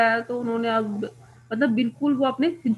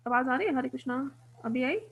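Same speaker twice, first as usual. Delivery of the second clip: ordinary talk, nothing marked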